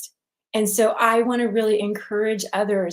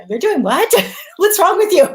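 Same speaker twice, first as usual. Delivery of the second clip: louder, higher mock scolding of an aunt, a laugh breaking in; stern disbelief; 11 semitones higher, 9 dB louder than usual. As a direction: play the stern aunt who can't believe it, half laughing. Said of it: "They'd look at me very sternly and go".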